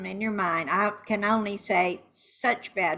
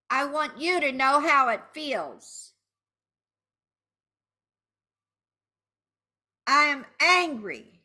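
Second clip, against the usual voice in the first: higher-pitched vengeful anger; angry venting; 7 semitones higher than usual. Shown as a demonstration. Betrayed partner to the cheater, angry venting. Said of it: "raise your voice".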